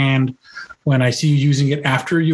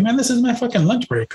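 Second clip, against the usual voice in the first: freaking out; 8 semitones higher than usual. Pretend. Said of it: "just wanna freak out and just be like".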